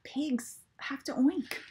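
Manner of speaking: whispered confiding aside. Real, not acted. Author confiding to children about the pigs, whispered. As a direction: whisper it like a secret.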